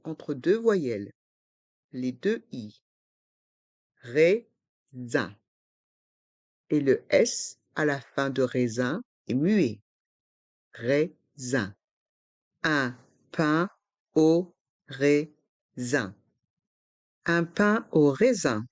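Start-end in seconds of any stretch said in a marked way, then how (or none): 4.0-5.4 syllable by syllable. Shown as a demonstration. Teacher breaks word into syllables. 10.7-11.7 syllable by syllable. Shown as a demonstration. Teacher breaks word into syllables. 12.6-16.2 syllable by syllable. Shown as a demonstration. Teacher breaks phrase into syllables.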